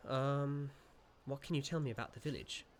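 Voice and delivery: ordinary talk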